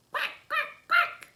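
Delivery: very high, loud squeaky quacks; tiny baby ducks peeping quack; duckling quacks. Acted. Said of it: "says the ducklings".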